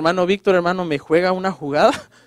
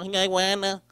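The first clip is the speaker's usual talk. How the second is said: stuffed-up cold voice, softer; congested; 2 semitones lower, 8 dB quieter, slower than usual. Acted. Pastor with a bad cold answers a caller, congested. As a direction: imitate someone with a terrible head cold. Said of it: "le contesto así todo como congestionado".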